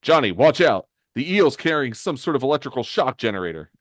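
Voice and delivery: shouted warning, then quick explanation; urgent warning. Acted. Hero warns teammate in battle.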